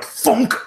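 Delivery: loud, high-pitched "thunk"; comic impact noise; imitated thunk. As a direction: punch out the sound of a blow. Game master imitates a blow.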